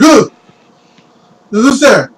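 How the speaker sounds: put-on high, loud startled kid; yelped challenge at an unexpected knock; startled jolt. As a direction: jump and call out in alarm. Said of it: "the kid just kinda immediately jolts".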